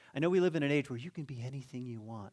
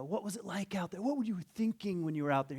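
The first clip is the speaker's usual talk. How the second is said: higher-pitched eager interviewer; put-on interviewer voice, pitched up; sports interviewer; 7 semitones higher than usual. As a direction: ask like a locker-room reporter. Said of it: "there's an interviewer".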